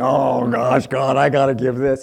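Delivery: loud, hammed-up reluctant groaning; grumbling. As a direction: grumble loudly like a put-upon giver. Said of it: "if I'm grumbling".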